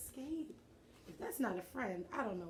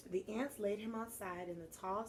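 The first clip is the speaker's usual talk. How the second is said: plain reading voice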